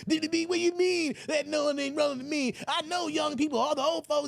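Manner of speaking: high squeaky shouting rant; Roland Martin impression. Roland insisting Republicans won't help, high shout.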